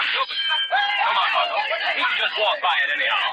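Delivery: high shout over the crowd; calling out. Acted. Lamont urging Margot through the noisy fair, shouting. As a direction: call her over the din.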